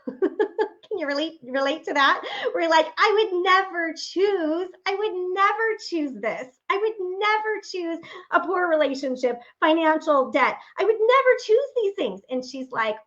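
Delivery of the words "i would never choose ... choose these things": mock protest. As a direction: protest as if it were absurd. Voicing everyone's protest.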